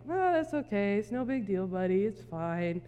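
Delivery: soft, laid-back reassurance, falling low; chill. Acted. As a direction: play the unbothered guy, easing down quietly. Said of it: "he's, like, chill".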